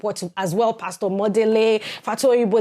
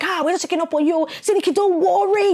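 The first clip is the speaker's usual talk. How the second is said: playful pastors' voice; 8 semitones higher than usual. Retold or imitated. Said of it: "they were just like".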